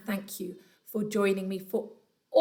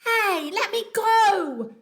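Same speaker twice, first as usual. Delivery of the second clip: louder, higher, slow protest; yelling; 10 semitones higher, 8 dB louder, much slower than usual. Acted. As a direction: crocodile yells while dangling. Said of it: "yelled the enormous crocodile".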